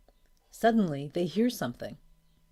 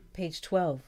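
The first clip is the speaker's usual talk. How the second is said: usual speaking voice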